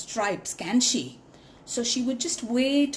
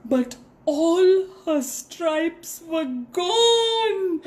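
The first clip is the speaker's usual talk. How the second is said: high, slow, crying narration; dismayed; 7 semitones higher, 3 dB louder, much slower than usual. A narrator's reading. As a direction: let the voice break in dismay. Narrating the shock.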